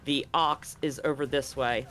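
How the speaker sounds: usual speaking voice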